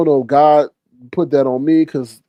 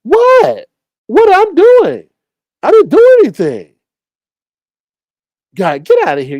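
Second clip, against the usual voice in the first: very high, louder, fake-innocent old man; mock innocent denial; 12 semitones higher, 6 dB louder than usual. The old man denying it to the police, high and loud. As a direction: play the guilty man protesting innocence, pitched way up. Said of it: "you know what he would have done?".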